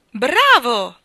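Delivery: loud, high cheer; cheering shout. Acted. Jester applauding the king's song, shouting bravo. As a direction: cheer him on. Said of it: "gridò il buffone".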